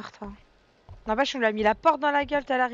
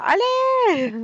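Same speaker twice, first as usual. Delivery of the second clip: shouting; 11 semitones higher, 5 dB louder than usual. Real, not acted. Shooing the monster away.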